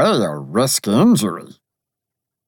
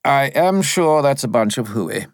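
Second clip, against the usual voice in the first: higher, lightly voiced spirit; a touch of airy dismissal; dismissive Bob; 6 semitones higher than usual. A narrator's reading. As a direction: brush off the alarm. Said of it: "the voice said".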